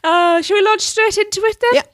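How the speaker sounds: ordinary talk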